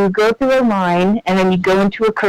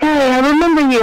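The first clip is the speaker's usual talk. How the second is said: higher and fast, cheerful recognition; excited greeting; 7 semitones higher, much faster than usual. Retold or imitated. Voice actor greeting the returning fan, excited. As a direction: burst out with it, quick and bright.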